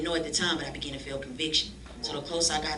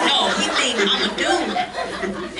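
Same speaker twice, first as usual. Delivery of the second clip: loud laughing outcry, pitched up; laughing protest; 8 semitones higher, 10 dB louder than usual. Retold or imitated. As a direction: laugh out that he thinks you're a dude. Laughing outrage at being mistaken.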